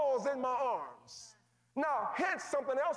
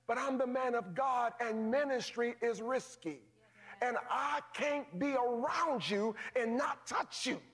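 usual speaking voice

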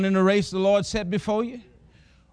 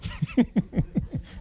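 breathy high laugh under the breath; 6 semitones higher, 3 dB quieter than usual. Real, not acted. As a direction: let a near-silent laugh slip out. Laughs at his fear of jail.

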